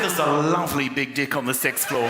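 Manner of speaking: imitated New Zealand receptionist, deck as dick, sixth as sex; matter-of-fact New Zealand accent with swapped vowels. Receptionist innocently describing the pool deck, New Zealand accent. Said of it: "She said".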